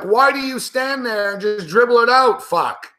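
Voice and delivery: usual speaking voice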